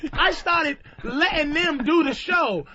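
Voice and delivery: ordinary talk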